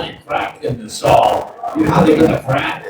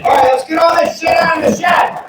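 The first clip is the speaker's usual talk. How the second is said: hammed-up chore call; fed-up homeowner; 14 semitones higher than usual. Homeowner announces the spring shed clear-out. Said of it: "Every spring".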